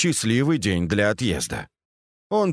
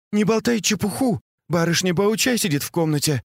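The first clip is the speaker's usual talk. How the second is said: ordinary delivery, nothing marked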